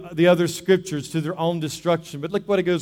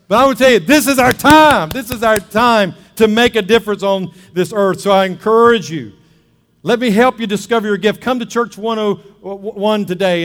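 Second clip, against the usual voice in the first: loud, high, rousing pulpit cry, easing gradually; rally the congregation at full voice; full-force preaching; 5 semitones higher, 9 dB louder than usual. Real, not acted. Preacher urging the church to make a difference, full-force preaching.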